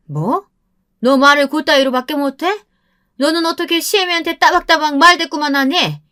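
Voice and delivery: high, loud outrage; indignant scolding. A narrator's reading. Mother-in-law outraged at being talked back to, indignant. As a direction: scold the daughter-in-law at the top of your pitch.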